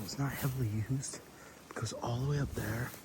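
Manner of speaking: hushed whisper near the deer. Real, not acted. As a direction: whisper so nothing spooks. Quiet talk near deer stand.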